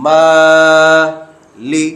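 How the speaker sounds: মালিকি as one word, very slow, the মা stretched one alif long; joined and drawn out. Shown as a demonstration. Teacher modelling the joined word for pupils, drawn out very slowly. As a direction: glide through মালিকি in one long breath, leaning on মা. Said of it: "একসাথে".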